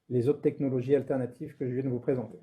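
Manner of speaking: usual speaking voice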